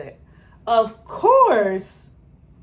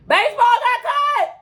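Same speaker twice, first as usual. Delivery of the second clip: outraged shout; 5 dB louder than usual. Real, not acted. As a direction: shout it out, pitch way up and loud. Outraged reaction to show news.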